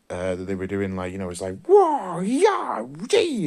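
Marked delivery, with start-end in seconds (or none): 1.6-3.5 very high, loud squealing fight cries; hammed-up kung fu movie shouts; mock kung fu yells. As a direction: yelp like a martial arts movie fighter. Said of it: "with the voice effects and stuff".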